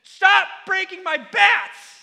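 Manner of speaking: put-on high, loud, slow kid's scream; screaming at uncle. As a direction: yell it like a fed-up eight-year-old. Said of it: "And I screamed at my Uncle Chuck".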